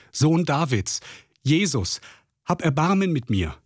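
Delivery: hoch gerufener Hilferuf, nicht lauter; laut rufen. A narrator's reading. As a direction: bettelnd aus der Ferne rufen, Stimme hoch. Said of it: "rief er laut". (blinder Bettler ruft Jesus an, hoch flehend)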